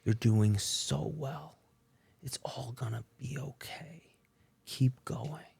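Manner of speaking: soft, slow whisper of comfort; whispered love. Retold or imitated. Wife whispering comfort to her husband, soft whisper. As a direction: breathe it gently, almost silent. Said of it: "And she says".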